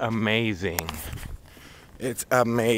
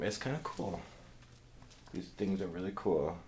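hushed; 5 semitones lower, 13 dB quieter than usual. Real, not acted. Talking quietly in doctor's office.

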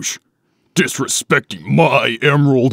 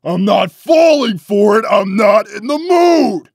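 shouted, higher refusal; angry shout; 7 semitones higher, slower than usual. Acted. Knuckles shouting off the fake alarm, angry. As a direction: shout him off, fed up.

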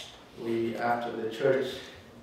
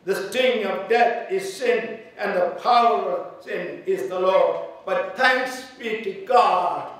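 louder, higher preaching at full force; 5 semitones higher, 5 dB louder than usual. Acted. Pastor preaching hope to the grieving family, fervent. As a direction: build the passage into a fervent peak.

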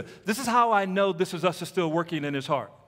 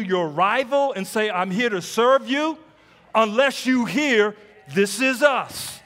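loud, raised preaching voice; full-force preaching; 4 semitones higher, 6 dB louder than usual. Real, not acted. Preacher challenging the congregation to serve a rival campus, full-force preaching. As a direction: drive each phrase home to the crowd.

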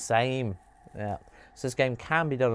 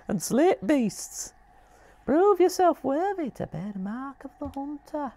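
very high, strange voice, slow in places; creepy hunter figure; 4 dB louder than usual. Acted. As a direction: tempt the player to the hunt. Voices a game character reading.

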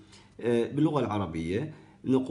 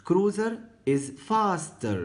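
slow model answer; 9 semitones higher, 3 dB louder, much slower than usual. Shown as a demonstration. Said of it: "teacher models the answer".

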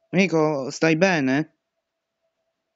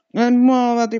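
urgent shout; 8 semitones higher, 5 dB louder than usual. Real, not acted. Urging teammate.

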